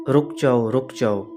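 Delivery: a hint of a low, eerie command to halt; mysterious warning voice. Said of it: "पीछे से एक आवाज़ आई".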